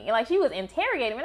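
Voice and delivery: usual speaking voice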